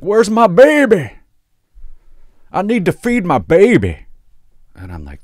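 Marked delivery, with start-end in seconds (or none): 0.0-4.1 put-on high, loud, slow drunken wail for her baby; drunk mom wail. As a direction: wail like a sloppy drunk mother. Drunk mom voiced in story.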